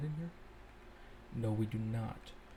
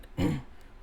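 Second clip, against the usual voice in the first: throat clearing; 3 semitones higher than usual. Real, not acted. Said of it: "Oh, voice crack".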